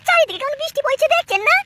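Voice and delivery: put-on high, pleading young wife's voice; worried daughter-in-law. Daughter-in-law begs her father-in-law not to leave in the rain, high and worried. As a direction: beg him high not to go out. Bride stops father leaving.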